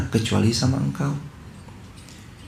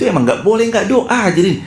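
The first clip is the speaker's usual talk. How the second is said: much higher, insistent preaching; preacher's emphatic high call; 10 semitones higher than usual. Real, not acted. Preacher urges the congregation to keep praying, pitched up high. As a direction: lift the voice to press the point. Preacher stresses must pray.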